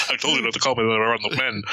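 low, fast stern scolding; low, quick rebuke in front of his men; stern villain. Retold or imitated. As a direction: snap at her in a deep stern voice.

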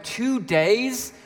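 high, drawn-out disbelief; mock-appalled questioning; incredulous outrage. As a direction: repeat it as if it's absurd. Acts out outrage at plumber's delay.

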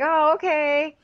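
very high, clueless imitation; mock naive agreement.

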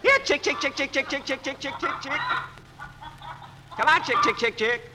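high sing-song chicken call. Acted. Calling the chickens to feed.

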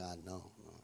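very quiet embarrassed denial; sheepish quiet. Retold or imitated. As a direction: mumble it sheepishly. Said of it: "And I'm like".

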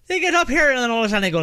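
fast, loud, high Irish jumble; mock Irish mumble. Mocking Irish speakers as a mash of words. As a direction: rattle it off like a fast Irish talker. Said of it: "I'm going Irish".

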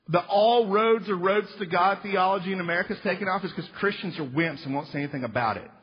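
ordinary talk

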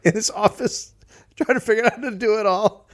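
high, lightly voiced bewildered bureaucrat; playing the lost official at his maps; mock flustered official. Official staring at maps, high mock-bewildered voice.

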